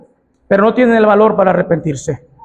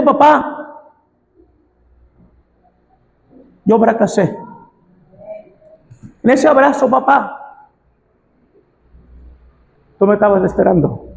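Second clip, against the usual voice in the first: súplica tierna. Acted. Tender voiced son's apology.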